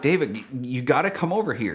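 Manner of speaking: ordinary delivery, nothing marked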